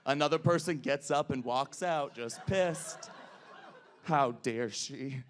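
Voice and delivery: normal talking voice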